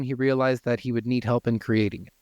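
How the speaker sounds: ordinary delivery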